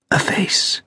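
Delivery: hushed, voiceless whisper. A narrator's reading. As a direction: whisper it with no voice at all. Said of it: "he whispered".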